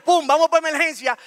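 ordinary delivery

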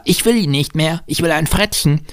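put-on high-pitched wanting little boy; demanding child. A narrator's reading. Boy handing over candlestick, high demanding child voice.

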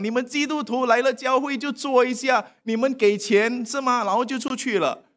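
imitating a scornful critic of Christians; sarcastic critic. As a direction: sneer at churchgoers like a scoffer. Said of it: "有些人就讲一些讽刺的话".

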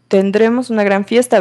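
ordinary talk, nothing marked

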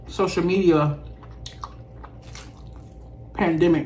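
chewing while talking; muffled by chewing; mouth full of food. Real, not acted. Seen 2: Bites burger before speaking; lips pursed, chewing motions during the words.

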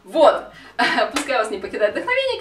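normal talking voice

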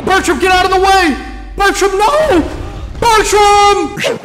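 very high-pitched, drawn-out yells; frantic yelling. Real, not acted. Gamer to Bertram in the way, yelling frantically. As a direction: scream the name in exasperated alarm.

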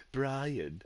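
cartoon British baby accent; clipped posh cartoon baby; Stewie voice. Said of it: "in Stewie's accent".

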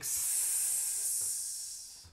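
long soft hiss; a drawn-out snake hiss. Acted. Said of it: "can you at least hiss like a snake?".

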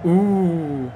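loud high gasp at a close chance; surprised gasp. Real, not acted. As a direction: gasp at a near miss. Near miss reaction.

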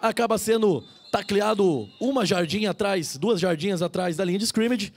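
usual speaking voice

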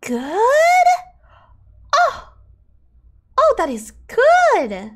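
drawn-out, high-swooping amazement; real delight. Real, not acted. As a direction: stretch it out slowly, rising with delight. Thrilled by the item's effect.

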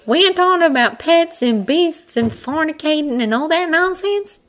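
high, dry little hare voice. A narrator's reading. Hare recounts the man's ranting, dryly. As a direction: recite the insults drily in a high voice. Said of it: "the hare asked dryly".

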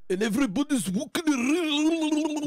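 high, garbled Scottish burr; rolled-tongue gibberish parody of Scottish; mock Scottish accent. Mocking Scottish speech as garbled.

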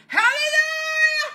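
high, drawn-out shouted hallelujahs; exultant acted praise, notes held long; church praise shout. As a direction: cry it out long and high from the pulpit. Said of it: "get up there and say".

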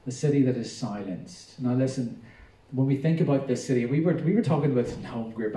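ordinary talk, nothing marked

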